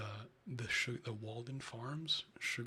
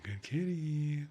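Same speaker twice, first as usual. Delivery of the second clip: cooing praise pitched up; 5 semitones higher, 8 dB louder, much slower than usual. Real, not acted. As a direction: gush over the cat up high. Praising cat.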